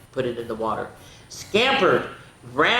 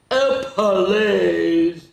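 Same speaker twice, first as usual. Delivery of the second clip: scoffing disbelief; 4 semitones higher, 7 dB louder than usual. Real, not acted. Reader scoffs at book claim.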